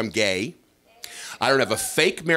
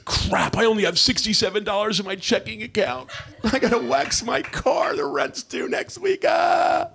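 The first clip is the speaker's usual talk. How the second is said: pot talking; 12 semitones higher than usual. Acted. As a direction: play the weed as a broke, whiny stoner going up and up. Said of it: "It was like".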